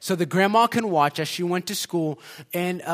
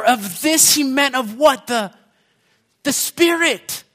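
raised, emphatic sermon voice; impassioned preaching; 4 semitones higher, 4 dB louder than usual. Real, not acted. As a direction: preach it out with conviction, pushing key words. Preaching with rising force.

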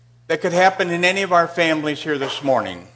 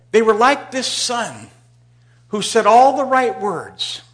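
preaching emphasis; 6 semitones higher, 7 dB louder, slower than usual. Real, not acted.

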